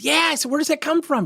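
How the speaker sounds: high, excited burst of eagerness; retold excitement. Coach thrilled the rep is being honest, excited. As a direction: pounce on the admission with high-pitched delight. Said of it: "Why are you so excited".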